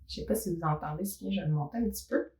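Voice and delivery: ordinary delivery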